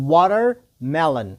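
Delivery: watermelon broken into "water", pause, "melon"; slow, split word. Shown as a demonstration. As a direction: say watermelon slowly in two separate parts. Teacher splits word for repetition. (watermelon ورو، په دوو برخو کې)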